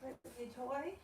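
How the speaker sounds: high, soft cooing. Real, not acted. Mother urging the baby to play, high and soft.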